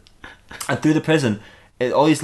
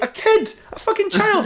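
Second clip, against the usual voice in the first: very high, shocked disbelief; hammed-up outrage, pitch leaping; appalled outcry. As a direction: squeal it out aghast. Appalled at the child's death.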